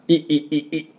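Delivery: "い" in short, high, tense bursts; repeated squeezed "い", clenched and high; strong strained i. Shown as a demonstration. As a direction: push out each "い" from the belly. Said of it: "ちょっと強いんですね。".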